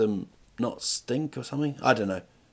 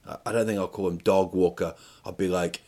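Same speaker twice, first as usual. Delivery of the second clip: usual speaking voice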